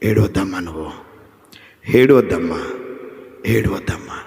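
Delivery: మెల్లగా, తగ్గిన స్వరంలో ఓదార్పు; తల్లిని లాలించినట్టు మృదువుగా, తక్కువ స్వరంలో; tender comforting Lord. Acted. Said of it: "నీ దగ్గరకు వచ్చి అంటున్న మాట". (ఏడుస్తున్న తల్లిని ప్రభువు ఓదారుస్తూ, మృదువుగా)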